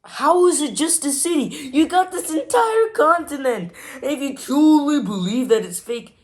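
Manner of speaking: high-pitched, disbelieving protest; incredulous outburst. Real, not acted. Incredulous outburst at the video's claim.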